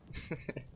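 soft, high-pitched chuckle; breathy little laugh. Real, not acted. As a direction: snicker quietly to yourself. Amused after cursing the stick.

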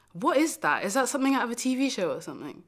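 worked-up, incredulous outburst; acted wounded indignation; indignant. Indignant at partner's cold answer.

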